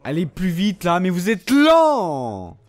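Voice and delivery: loud, slow exasperated shout; fed-up gamer nagging the game; impatient yell. Real, not acted. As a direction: drag out the complaint in annoyance.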